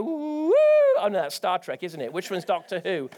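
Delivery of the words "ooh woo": mock sci-fi woo. Mock time machine sound.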